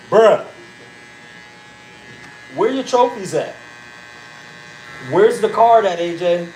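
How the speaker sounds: loud, high-pitched parental demand; retold angry yelling. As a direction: act out grilling your son. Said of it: "I was yelling at him a bit".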